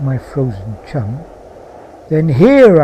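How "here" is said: loud, high exclamation; sudden cry. A narrator's reading. Man finding a place to burn his pal, sudden cry. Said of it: "said with a sudden cry".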